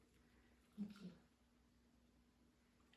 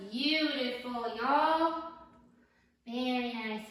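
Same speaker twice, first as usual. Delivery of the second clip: rising, louder delighted praise; 3 semitones higher, 5 dB louder, slower than usual. Real, not acted. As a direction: lift the voice with delight. Praising the dogs' release.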